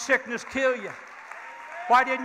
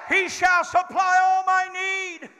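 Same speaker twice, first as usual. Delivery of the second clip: shouted affirmation; 6 semitones higher, slower than usual. Shown as a demonstration. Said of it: "Say it with me one more time".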